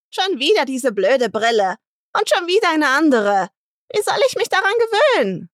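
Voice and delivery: high, loud, scolding complaint; grumbling old woman. Acted. Old woman complaining about new glasses, high loud scolding. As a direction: grouse loudly about the glasses. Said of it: "Marita schimpfen".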